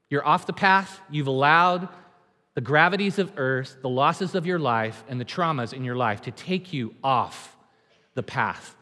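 usual speaking voice

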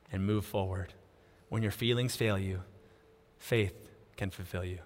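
low, soft preaching voice; gentle closing exhortation. Real, not acted. Preacher urging the congregation to climb on, gentle closing exhortation. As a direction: drop low and quiet, leaving pauses between phrases.

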